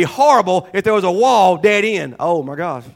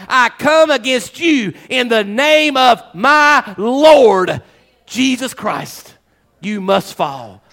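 solemn slow declaration; much slower than usual. Acted. Climactic declaration to Shaquille.

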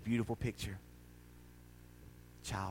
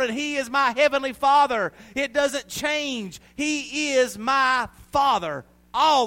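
loud, high, drawn-out pulpit shout; full-force preaching; 15 dB louder, slower than usual. Real, not acted. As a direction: proclaim each phrase slowly at full cry.

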